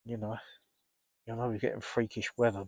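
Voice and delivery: ordinary talk, nothing marked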